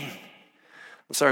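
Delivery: usual speaking voice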